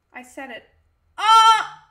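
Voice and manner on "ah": short, loud, high scream; loud startled-sounding "Ah!"; sudden scream. Real, not acted. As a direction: let out a sharp high yell. Sudden scream during stream.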